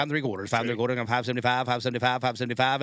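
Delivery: rapid-fire auctioneer chant. Acted. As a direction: rattle off bids like an auctioneer. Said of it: "that aucautioneer voice is coming through".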